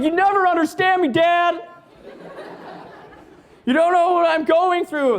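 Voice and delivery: put-on high, whining teen voice; a sulky teenager wailing at Dad; whiny teenager. Teen Jesus whining to his dad God.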